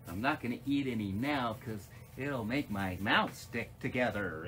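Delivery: ordinary delivery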